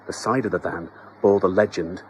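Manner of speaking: plain reading voice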